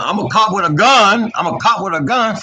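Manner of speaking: mock meltdown of a cop; mock emotional. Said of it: "and I'm emotional".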